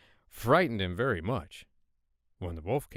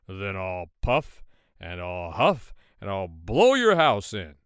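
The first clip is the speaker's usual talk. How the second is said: wolf threat; 3 semitones lower, 6 dB louder, slower than usual. Acted.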